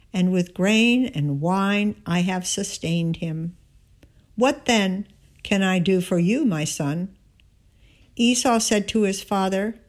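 measured, solemn lectern reading pitched high; scripture reading. Acted. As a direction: read the passage aloud to the congregation.

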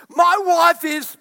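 high, loud exasperated grumble about the wife; hammed-up griping; loud mock complaint. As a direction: vent it like a fed-up husband.

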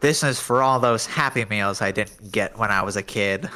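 nasal, pinched-nose Squidward voice; pinching nose. Acted. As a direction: say it holding your nose shut. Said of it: "pinch your nose and say it like you're Squidward".

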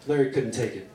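plain reading voice

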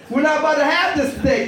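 ordinary talk, nothing marked

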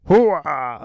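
loud barked hooah sign-off; Al Pacino. Retold or imitated. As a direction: bellow it like a gung-ho soldier. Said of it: "Al Pacino here".

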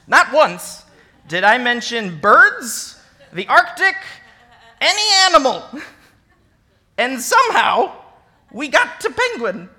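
half-laughing exasperation; incredulous. Real, not acted. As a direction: build to amused outrage. Teacher recounting absurd question.

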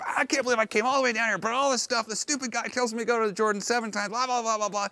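high, fast, exasperated grumbling; mock indignant rant. Insulted commander storming off, fast huffy rant. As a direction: sputter it out like a wounded ego. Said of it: "he storms off with his entourage".

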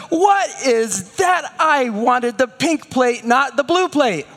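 put-on high, slow, indignant child shout; demanding toddler. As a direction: throw a little tantrum. Toddler demanding pink plate.

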